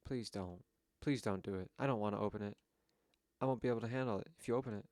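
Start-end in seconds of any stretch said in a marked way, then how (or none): none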